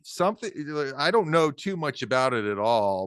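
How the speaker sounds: ordinary delivery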